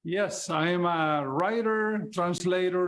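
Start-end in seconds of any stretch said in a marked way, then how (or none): none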